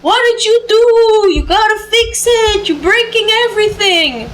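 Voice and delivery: put-on high, loud old Greek man accent. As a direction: scold like an old Greek grandpa. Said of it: "His old, like, Greek accent".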